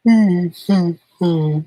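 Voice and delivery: normal talking voice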